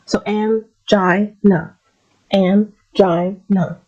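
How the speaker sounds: "angina" stretched out very slowly, an-JAI-na; very slow pronunciation. Shown as a demonstration. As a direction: draw out each syllable of "angina". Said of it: "break it down in the words".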